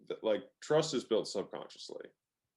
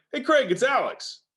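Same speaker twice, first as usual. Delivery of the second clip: much higher, slow, Craig lifted; questioning tone; 9 dB louder than usual. Shown as a demonstration. Salesman on the phone implying a question to Craig. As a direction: sing it up like you're asking. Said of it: "Emphasis goes up, question".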